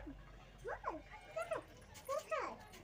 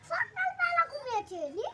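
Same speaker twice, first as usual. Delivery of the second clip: loud, high insisting that it's night; insistent shout; 5 semitones higher, 15 dB louder than usual. Real, not acted.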